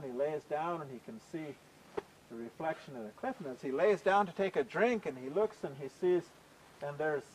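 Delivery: ordinary delivery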